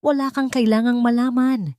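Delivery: loud, high shout; mother yelling to shut him down; losing control, she yells. A narrator's reading. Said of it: "sigaw ni Isabela".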